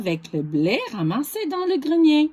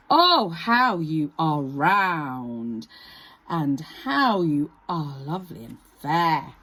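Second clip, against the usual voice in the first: slow, low honeyed flattery; crafty fox sweet-talking; sly fox flattery; 7 semitones lower, slower than usual. Acted. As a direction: draw out the compliments.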